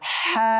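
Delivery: হা pressed out from mid-throat. Shown as a demonstration. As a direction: squeeze a strong breathy h from the middle of the throat on হা. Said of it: "এটা চাপ দিয়ে পারবো". (হা কণ্ঠনালীর মধ্যাংশ থেকে চাপ দিয়ে)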